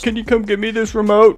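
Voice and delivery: high, loud holler; called out across house. Retold or imitated. Parents calling from across the house, high and loud. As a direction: yell it from the other room.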